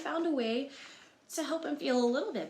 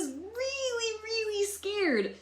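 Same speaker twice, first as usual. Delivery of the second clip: much higher, slow, squeaky frightened voice; mock scared; 14 semitones higher, much slower than usual. Reader acting out scared Humpty for children, mock fright. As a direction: play up the fear for kids. Describes the egg's fear.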